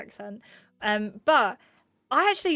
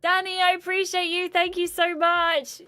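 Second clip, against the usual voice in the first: gushing thanks; 8 semitones higher, 4 dB louder than usual. Real, not acted. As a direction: pour out the thanks. Thanking a viewer.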